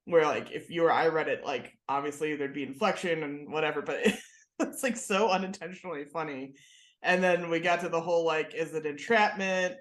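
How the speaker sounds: ordinary talk, nothing marked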